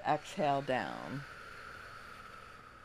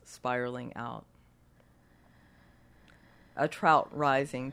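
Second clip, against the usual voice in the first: guided meditation. Acted. Leads a meditation.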